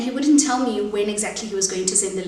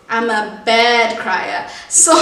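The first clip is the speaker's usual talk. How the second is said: teary; 3 semitones higher, 7 dB louder, slower than usual. Real, not acted. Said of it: "I'm a very, very ugly crier".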